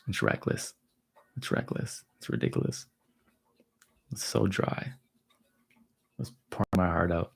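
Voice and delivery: quiet, near-breathless mock exasperation; muttered complaint. Real, not acted. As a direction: mutter it like a private gripe. Muttering complaints about daughter's texts.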